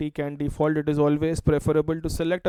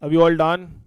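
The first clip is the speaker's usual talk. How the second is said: loud call to the classroom; 7 dB louder than usual. Real, not acted. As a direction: call out across the room. Teacher calling out to class.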